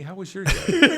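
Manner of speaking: laughing mock greeting. Laughing as he greets his tiles like his wife. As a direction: greet the tiles as if they were a spouse, laughing. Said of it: "It was like".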